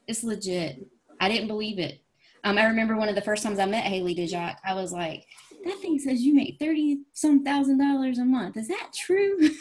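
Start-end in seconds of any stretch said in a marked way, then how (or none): 5.6-9.5 high, wide-eyed question rising higher; retold awe at the income chart; amazed disbelief. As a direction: ask like it can't possibly be true. Asking top earner about pay.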